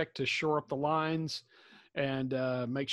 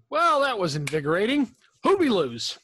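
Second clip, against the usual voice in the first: higher, louder, breezy post-battle cheer; hearty in-character quip; 6 semitones higher, 7 dB louder than usual. Acted. Fighter claps hands after the bloody battle, hearty and breezy. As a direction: toss it out brightly, pitched up and loud. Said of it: "clap my hands and say".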